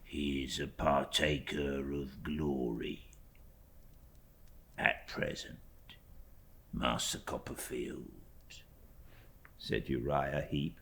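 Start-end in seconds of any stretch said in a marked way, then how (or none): 0.0-8.1 fawning humble clerk. Acted. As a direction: lay the humility on thick. Uriah's voice in story reading.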